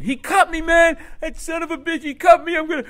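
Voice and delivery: high, loud outraged yelling; furious high-pitched holler; screaming wounded guy. Acted. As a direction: scream it like a man who's been cut. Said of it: "this guy's now screaming".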